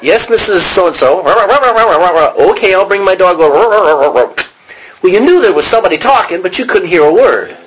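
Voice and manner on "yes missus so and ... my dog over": brightly voiced half of a phone call, pitched up; lightly acted phone call to the neighbour, higher; phone chatter imitation. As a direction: mimic a cartoon adult on the phone. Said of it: "whenever somebody was on the phone".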